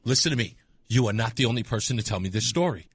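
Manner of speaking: ordinary talk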